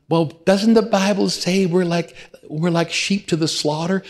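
high mock-timid questioning; mock meek churchgoer; whiny doubter voice. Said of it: "live your life like some".

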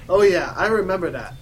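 loud, lightly voiced headmaster; mock gothic Dumbledore. Dumbledore trying to seem gothic, mock cool. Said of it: "trying to be all gothic".

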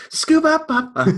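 high scat of a sax riff; bad saxophone impression. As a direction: scat a cheesy sax line. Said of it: "a really bad saxophone impression".

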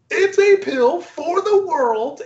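grand crazy scientist. Retold or imitated. Crazy scientist proclaiming his pill to the suits, grandiose. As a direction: announce it like a mad visionary. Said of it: "plays this crazy scientist".

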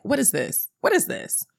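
quick, repeated questioning as a mock grandmother; African grandmother. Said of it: "And the grandmother, who's African, is like".

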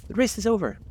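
sudden high-pitched call. A narrator's reading. The dodo to the runners, calling out suddenly. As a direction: announce it suddenly, voice leaping up. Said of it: "the dodo suddenly called out".

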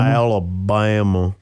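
slow Southern drawl on Alabama; Alabama accent. Shown as a demonstration. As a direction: stretch Alabama out Southern style. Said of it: "like people in Alabama say it".

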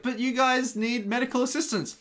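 put-on kid's voice, pitched well up and earnest; high mock kid voice. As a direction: play the pushy helpful child. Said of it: "It's like".

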